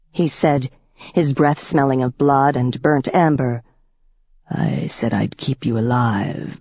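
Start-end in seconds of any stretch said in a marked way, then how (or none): 4.5-6.6 lower, slow and cold vampire voice; a predator savoring every word; menacing old vampire. A narrator's reading. Piscary threatens Rachel.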